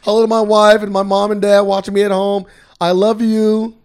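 loud, even, stiff press-conference thank-you; mock robotic athlete interview; imitating Tom Brady. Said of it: "This man is a robot".

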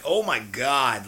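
slow, loud, stunned "oh my God"; a retold stunned exclamation; shocked gasp. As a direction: reel back as the line hits. Reacting to the first line of meth.

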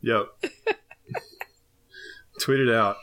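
normal talking voice

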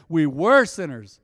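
loud call-out with "were" stretched; rallying call. Shown as a demonstration. Preacher leading the congregation's response, loud and slow. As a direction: belt it out slowly for others to repeat.

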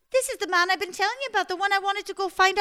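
high, loud, fast tumbling relief; overjoyed rush. Acted. Margaret greeting her lost Simon, high, loud and rushed. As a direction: gush it all out at once, high and breathless.

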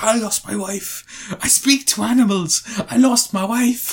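hammed-up mournful Welsh hero; mock Doolittle. Doolittle answering the plea for help, mock mournful. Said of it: "And he's like".

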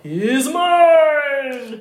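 hammed-up claim; mock shout in that tone. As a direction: bellow it with comic possessiveness. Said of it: "huutaa sellaisella nuotilla".

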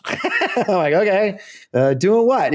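amused disbelief with a laugh. Real, not acted. Hearing Steve's job offer, laughing.

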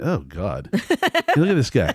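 slow, disgusted look at the mirror; self-mocking groan. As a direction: drag it out at your own reflection. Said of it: "I'm in the mirror going".

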